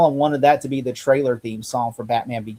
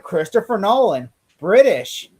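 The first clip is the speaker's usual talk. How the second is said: excited execs; 6 semitones higher than usual. Retold or imitated. Voicing excited studio execs.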